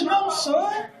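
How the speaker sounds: lightly voiced mom; imitated patient mother. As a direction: gently set the boy straight. Mother answers her excited son.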